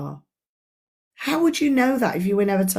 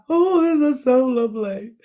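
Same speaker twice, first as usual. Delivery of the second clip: mock gushing; 5 semitones higher than usual. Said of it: "And we're like".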